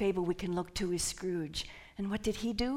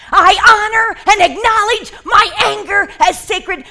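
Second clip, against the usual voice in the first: high, full-force shout; fiery anger affirmation; 12 semitones higher, 23 dB louder than usual. Shown as a demonstration. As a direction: shout it out with fire energy. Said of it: "This is our fire energy".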